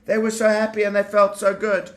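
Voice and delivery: usual speaking voice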